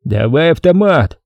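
contained angry demand, drawn out; understated narrated shout, stretched; angry yell. A narrator's reading. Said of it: "уже зло кричит второй".

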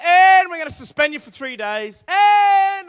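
loud "and" stretched out high, punishment unfinished; pitch left hanging. Acted. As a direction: hold "and" up like a looming comma. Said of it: "we're still at that pitch".

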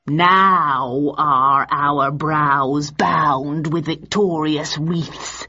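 very slow, drawn-out declamation; Richard III. A narrator's reading. Richard ignoring the audience, declaiming slowly. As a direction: carry on grandly, unbothered by the crowd. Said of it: "ignoring them completely".

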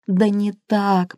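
ordinary delivery

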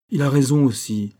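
s'écrier avec entrain, plus fort; exclaiming. A narrator's reading. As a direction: approbation lancée à voix haute. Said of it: "s'écria le capitaine". (le capitaine approuve le jeune attaché, d'une voix forte)

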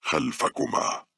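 slow, drawn-out words; eerie reply. A narrator's reading. Unseen young man answers from behind, slow and drawn out. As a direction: stretch the word out slowly. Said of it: "أجابها وهو يلمس كتف باولا قائلا".

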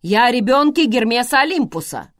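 loud insistent shout. Acted. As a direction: press the point loudly. Tomas keeps shouting.

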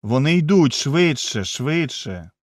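frightened cry of the fleeing crowd; panicked shout fading. A narrator's reading. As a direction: urge everyone on, then fade.

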